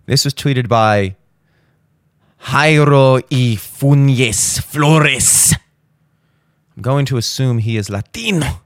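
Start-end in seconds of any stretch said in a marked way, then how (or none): 2.4-5.5 put-on Spanish accent.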